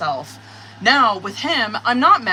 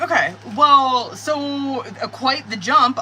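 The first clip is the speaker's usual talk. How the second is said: slow, louder, dry quite the jump; dry sarcasm; 4 semitones higher, 6 dB louder, slower than usual. Retold or imitated. Reader reacting to his sudden jump, slow dry sarcasm. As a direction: drawl it out, unimpressed. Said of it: "And I'm like".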